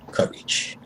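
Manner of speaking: breathy whisper of "courage". Shown as a demonstration. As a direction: say "courage" as a secret. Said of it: "whispered answer".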